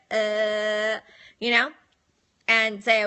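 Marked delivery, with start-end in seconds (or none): 0.1-1.0 long level "uh"; held, monotone demonstration sound; demonstrated scrubbing noise. Shown as a demonstration. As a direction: drone an "uh" while miming tongue-scrubbing. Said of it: "I'd be like this".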